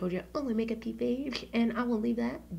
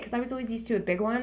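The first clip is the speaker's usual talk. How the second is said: ordinary talk, nothing marked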